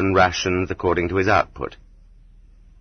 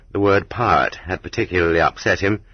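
usual speaking voice

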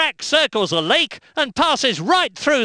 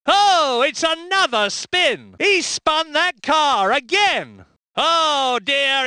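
higher, rising alarm at a sudden spin; excited; 7 semitones higher, slower than usual. Acted. Race commentator reacting to a car spinning off, excited. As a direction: gasp out the news of the spin, pitch up.

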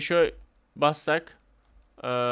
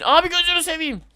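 high, loud thrilled cry; gleeful high-pitched outburst; thrilled exclamation; 12 semitones higher, 4 dB louder than usual. Real, not acted. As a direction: exclaim in delight as you're launched. Excited outcry.

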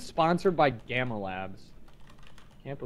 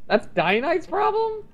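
high damsel voice; slower than usual. Pretend. High mock NPC quest voice.

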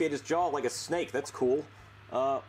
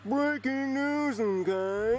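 higher, drawn-out newsreader impression; Mister Goodman; 7 semitones higher, 3 dB louder, much slower than usual. Shown as a demonstration. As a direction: stretch it out like a hammy announcer. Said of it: "I can do a mean Mister Goodman".

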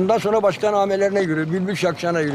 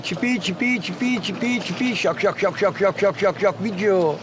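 bird song demo. Shown as a demonstration. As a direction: imitate a finch's whole song phrase. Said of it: "önleri böyleydi". (saka ötüşü taklidi)

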